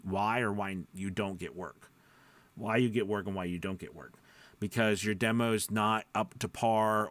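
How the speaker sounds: ordinary talk, nothing marked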